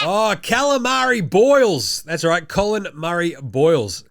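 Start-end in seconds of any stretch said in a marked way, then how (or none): 0.0-2.0 higher, louder and slow, like announcing a fighter; drawn-out hype call. Real, not acted. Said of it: "Calamari Boyles, we call him".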